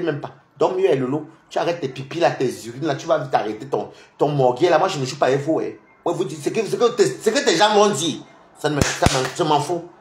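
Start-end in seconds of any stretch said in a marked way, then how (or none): none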